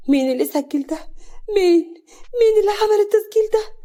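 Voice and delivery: high, loud, tearful panic; frantic fear. A narrator's reading. Exposed wife demanding who made the recording, frantic and frightened. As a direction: cry out wildly, pitch climbing sharply. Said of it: "كريمة بسعر وخوف".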